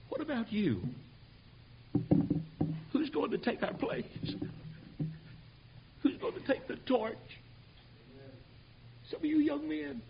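high, strained plea to the congregation; high-pitched, imploring challenge; pleading appeal. Real, not acted. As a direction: plead in a high voice, with long pauses between questions.

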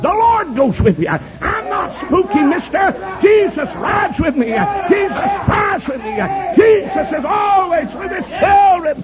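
high-pitched, drawn-out proclamation; fervent preaching. Real, not acted. Preacher answering the charge of being spooky, fervent preaching. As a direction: cry it out to the whole church, high and stretched.

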